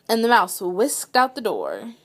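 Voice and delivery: ordinary delivery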